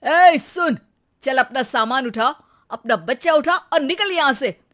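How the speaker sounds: husband snaps at wife to get out; angry order. Acted. Husband throws his wife out of the house, angrily. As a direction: throw her out in anger. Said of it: "गुस्सा हो जाता है".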